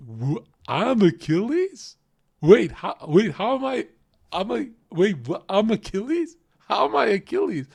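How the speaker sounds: a guy who can't believe the compliment; mock eager Chad. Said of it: "And he goes like this".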